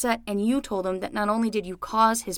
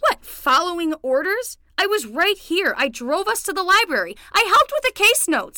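indignant defense; 7 semitones higher than usual. Acted. Hurt defense, rising.

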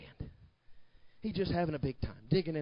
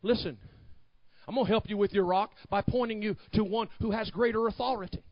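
ordinary talk

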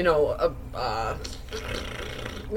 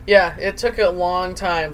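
normal talking voice

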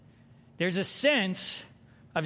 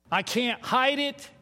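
ordinary talk, nothing marked

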